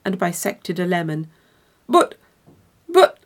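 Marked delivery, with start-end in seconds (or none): 1.9-3.2 much higher, louder stammering but, but; a higher, louder stunned stammer; flustered sputter. Acted. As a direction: sputter in shock. Large man sputters through uproar.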